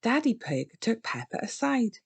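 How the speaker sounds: plain reading voice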